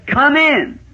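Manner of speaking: high called-out welcome; "Come in" called high; calling come in. Retold or imitated. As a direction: invite him in from the yard. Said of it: "he said".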